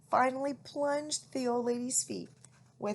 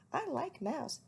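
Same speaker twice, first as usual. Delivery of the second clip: owlet voice; 6 semitones higher than usual. A narrator's reading. Plop voiced in story.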